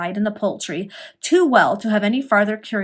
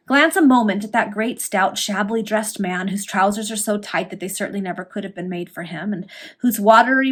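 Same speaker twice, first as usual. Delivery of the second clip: usual speaking voice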